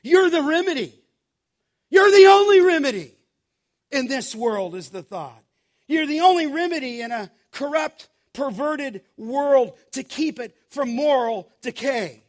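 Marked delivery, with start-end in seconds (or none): 0.0-1.0 higher and louder, You're punched hard; emphatic preaching. Real, not acted. Preacher climax, repeated claim. 1.9-4.7 much higher and much louder, faster, peaking on only; a ringing shout from the pulpit; full-force preaching. Real, not acted. Preacher climax, repeated claim. 5.9-12.2 higher and louder, faster, stressing only; fervent preaching. Real, not acted. Preacher sustained climax.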